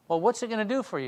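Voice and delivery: normal talking voice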